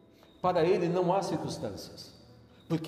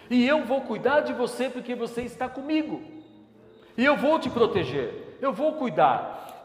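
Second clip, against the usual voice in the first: higher, louder impassioned sermon; fervent preaching; 6 semitones higher, 5 dB louder than usual. Acted. Pastor urges the congregation in the storm, fervent. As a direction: preach it with full fire.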